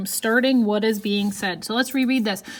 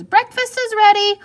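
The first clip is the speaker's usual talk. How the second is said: high, loud call downstairs; 11 semitones higher, 8 dB louder than usual. Shown as a demonstration. Jasmine calling from downstairs to her mother, loud and high. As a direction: call up to mom that breakfast is ready. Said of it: "You notice how my voice changes when things have quotations".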